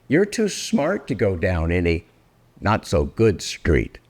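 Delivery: usual speaking voice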